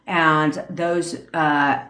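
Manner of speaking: normal talking voice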